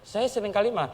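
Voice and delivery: normal talking voice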